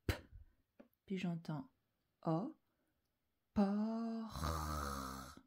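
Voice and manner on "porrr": "por." drawn out with a long rasped French R; sounding out. Shown as a demonstration. Teacher showing the next sound heard, sounding out. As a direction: stretch "por." so the final R rings on. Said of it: "Ensuite, j'entends R".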